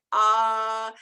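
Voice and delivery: "ah" held long and loud; tongue-out ah. Shown as a demonstration. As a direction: say "ah" for the doctor, drawn out. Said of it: "Stick out your tongue and say".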